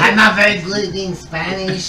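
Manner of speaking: slow, laughing mock Spanish accent; hammed-up Spanish accent; bad Spanish accent. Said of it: "worst Spanish accent".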